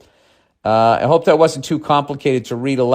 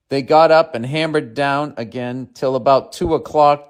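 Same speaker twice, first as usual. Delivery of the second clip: ordinary talk, nothing marked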